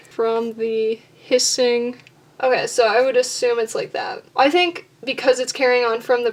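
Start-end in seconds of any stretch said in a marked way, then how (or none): 0.1-2.0 slow, measured word-by-word scansion; sounding out stresses. Shown as a demonstration. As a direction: draw out "From the hissing" to test each syllable. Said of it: "where are the syllables that are being emphasized".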